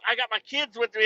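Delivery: normal talking voice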